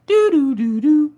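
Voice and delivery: put-on high sung shutdown tones like an old Windows PC; computer shutdown jingle. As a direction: hum the brain switching off like a dying computer. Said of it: "shut down like an old Windows system".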